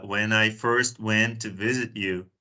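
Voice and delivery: usual speaking voice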